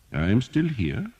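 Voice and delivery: put-on dry, ironic elderly doctor lecturing; old doctor voice. A narrator's reading. Doctor answers.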